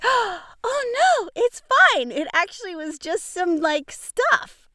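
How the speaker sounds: high, bright-eyed mock relief after a gasp. Host retelling finding her mic fine, high mock relief.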